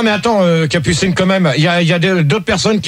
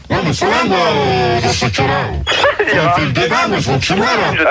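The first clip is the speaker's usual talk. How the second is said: odd, faltering voice, dropping low; sulky words in a strange, half-voiceless voice; drôle de voix; 5 semitones lower than usual. Real, not acted. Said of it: "T'as une drôle de voix". (drôle de voix, qui se dérobe)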